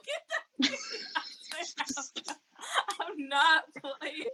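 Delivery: high, laughter-filled speech; slowing down as the laughing takes over; laughing hard. Real, not acted. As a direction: crack up and push the words out through it. Cracking up at her own scenario.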